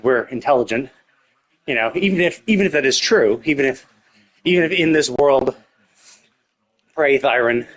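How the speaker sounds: usual speaking voice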